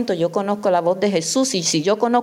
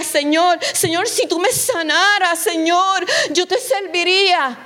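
high, insistent mock prayer; acted pleading; 6 semitones higher than usual. Believer begging the Lord for children, house and healing, acted pleading. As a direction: plead with God in a raised pitch, imitating believers.

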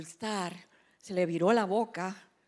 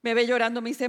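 ordinary talk

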